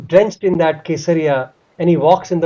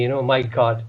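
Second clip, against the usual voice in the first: moved hush; 6 semitones lower, 7 dB quieter than usual. Real, not acted. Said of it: "it was shaking me".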